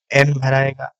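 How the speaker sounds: ordinary delivery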